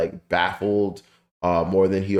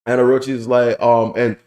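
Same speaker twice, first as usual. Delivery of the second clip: usual speaking voice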